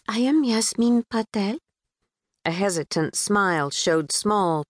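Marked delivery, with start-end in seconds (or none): 0.1-1.6 put-on a touch of Pakistani accent. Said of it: "tried to peg the accent, a bit sing-song, infused with a British twang".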